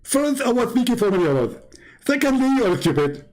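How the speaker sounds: a hint of Zakir Naik, smug and dismissive; mock Zakir Naik. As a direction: parody a smug preacher answering a caller.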